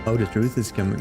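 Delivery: ordinary talk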